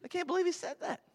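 high, scoffing disbelief; mock disbelief. Preacher mimicking the amused crowd's disbelief. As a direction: scoff like you can't believe it. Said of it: "They're just like".

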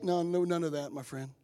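ordinary talk

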